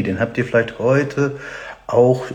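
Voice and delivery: ordinary talk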